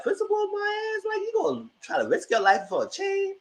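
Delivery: high scoffing "Principle, my ass?"; scoffing disbelief. Retold or imitated. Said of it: "I was like".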